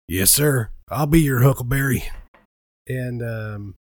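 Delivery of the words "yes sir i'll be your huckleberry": slipped into another character's voice; wrong character voice, louder, put-on; shifting shapeshifter voice. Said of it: "That's not your voice. You're being Reginald right now".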